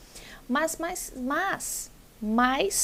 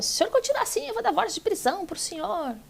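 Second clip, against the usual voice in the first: imitating the judge's threat; 7 semitones higher, much faster than usual. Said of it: "E ela falou".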